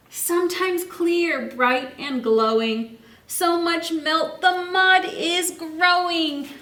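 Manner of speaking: slow, lilting rhyme reading; read-aloud storytime. Acted. Teacher reads the rhyme to her class, slow and lilting. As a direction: savour each line for the kids. Reads rhyming book text.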